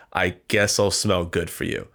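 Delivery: normal talking voice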